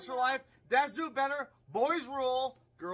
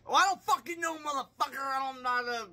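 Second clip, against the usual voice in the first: annoyed; 5 semitones higher, 5 dB louder than usual. Real, not acted. Irritated comeback after being teased.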